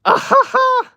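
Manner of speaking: loud, high burst of laughter; real high-pitched laugh; high cackling laugh. Real, not acted. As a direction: cackle at your own joke. Signing off with a joke.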